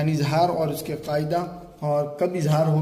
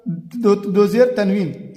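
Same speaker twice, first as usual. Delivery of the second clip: usual speaking voice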